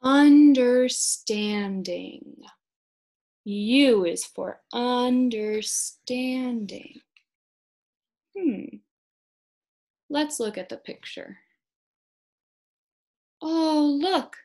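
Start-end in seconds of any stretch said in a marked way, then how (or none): 0.0-7.0 slow word intro. Shown as a demonstration. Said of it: "introduces the word slowly". 13.4-14.4 high oh look; mock surprise. Points out picture excitedly.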